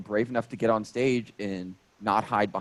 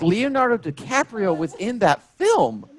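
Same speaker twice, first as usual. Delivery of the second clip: higher, louder and slow, each word pointed; exasperated retort; 10 semitones higher, 5 dB louder, slower than usual. Retold or imitated. Comedian correcting the fan, slow exasperated mock retort. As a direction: correct her with mock disbelief. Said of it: "I was like".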